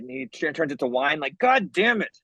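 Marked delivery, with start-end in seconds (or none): 1.4-2.2 higher-pitched mock-annoyed cursing; hammed-up frustration; exasperated outburst. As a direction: curse in comic exasperation at a miracle gone wrong. Acted drinker cursing wine miracle.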